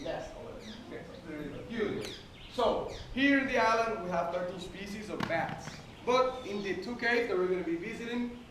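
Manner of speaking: ordinary talk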